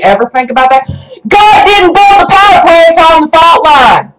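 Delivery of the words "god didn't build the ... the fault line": high-pitched, indignant yell; angry outburst. Real, not acted. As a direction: burst out in real exasperation. Angry rant peaks in outburst.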